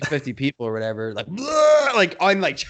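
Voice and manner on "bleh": loud, high vomiting noise; blurted "bleh" of puking; mock retch. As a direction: imitate a sudden burst of throwing up. Imitating himself throwing up.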